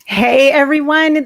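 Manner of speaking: ordinary talk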